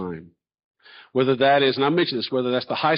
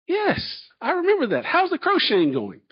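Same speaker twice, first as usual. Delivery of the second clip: high, insistent yes to a stunned colleague; high-pitched retold reply. College leader replaying his answer to a stunned employee, high and emphatic. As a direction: reenact it high and emphatic, falling at the end.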